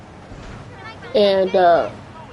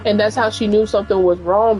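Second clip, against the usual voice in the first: normal talking voice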